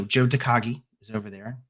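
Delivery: ordinary delivery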